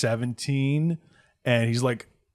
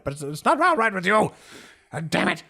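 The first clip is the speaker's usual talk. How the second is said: angry; 10 semitones higher, 5 dB louder than usual. Acted. Innkeeper angry over kid.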